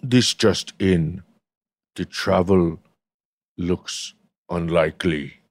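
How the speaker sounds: low, slow put-on announcement with long pauses; mock news bulletin. Friend mocks castmate's travel plans, as a slow low bulletin. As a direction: announce it low, breaking between phrases.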